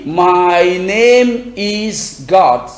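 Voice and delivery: very slow, each word set apart; slow model answer. Shown as a demonstration. Teacher modelling the answer slowly to a student. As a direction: spell it out slowly for the learner. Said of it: "Tu vas dire".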